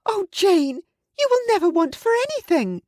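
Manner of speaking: very high, fast, overjoyed squeal; Missus Bennet gushing. Acted. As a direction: rattle it off in a very high excited squeal. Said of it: "she cried".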